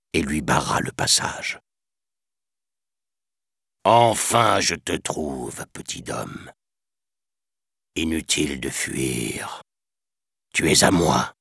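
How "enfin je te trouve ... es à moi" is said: gloating tiger. Acted. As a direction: savour every word like a predator. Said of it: "affirma le tigre avec délectation".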